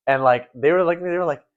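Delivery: ordinary delivery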